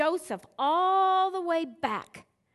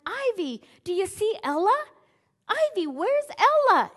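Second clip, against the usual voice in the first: playful calling; 6 semitones higher, 5 dB louder than usual. Retold or imitated. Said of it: "and I'm going".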